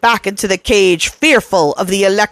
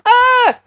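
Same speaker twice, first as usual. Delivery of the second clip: cry of alarm. A narrator's reading.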